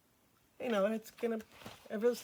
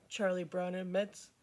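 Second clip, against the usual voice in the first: ordinary delivery, nothing marked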